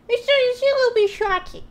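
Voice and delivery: playful high-pitched mock shock. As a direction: echo it in a silly, high-pitched voice. Repeats own remark in put-on voice.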